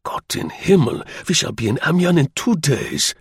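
put-on high, German-accented excitement, building; feverish excitement. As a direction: announce the news feverishly. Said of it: "charged with feverish excitement".

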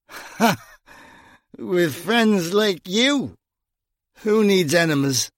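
slow, sneering jeer; scoffing drunk. A narrator's reading. Drunk Gringo mocking Bunny's friendship, slow and sneering. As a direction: laugh it off with contempt.

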